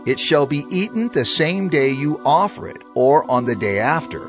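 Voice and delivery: usual speaking voice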